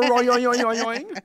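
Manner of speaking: high bouncy spring sound, yoing yoing; springy boing noise. Retold or imitated. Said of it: "You got the spr".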